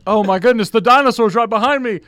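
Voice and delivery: hammed-up fright over an imaginary dinosaur; mock alarm. Improv kid pretending a dinosaur is behind him, hammed-up alarm. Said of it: "I'm like".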